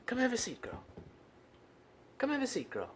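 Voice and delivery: high, soft pet-talk; small, high, encouraging voice; gentle coaxing. Real, not acted. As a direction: coax a shy animal softly, voice up high. Coaxing a shy cat.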